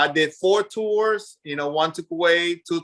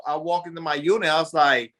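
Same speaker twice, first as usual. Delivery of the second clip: ordinary delivery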